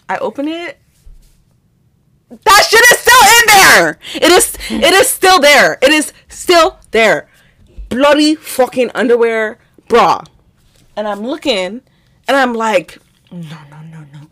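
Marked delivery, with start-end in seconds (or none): 2.3-7.3 high, loud outraged disbelief; scandalized cry at a gross find; appalled discovery. Retold or imitated. Shocked discovery, retold. 13.3-14.2 low, barely voiced muttering; stunned whisper under the breath; hushed disbelief. Retold stunned reaction to underwear.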